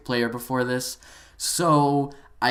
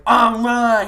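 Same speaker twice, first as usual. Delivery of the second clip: high, loud flustered noise; mock angry groan; 8 semitones higher, 8 dB louder than usual. Storyteller imitating a mad player without comebacks, loud mock groan. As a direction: imitate someone mad and out of words. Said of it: "he just so mad".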